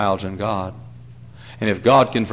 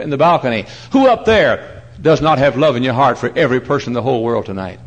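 ordinary talk, nothing marked

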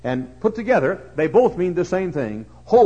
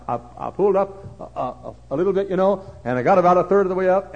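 ordinary talk

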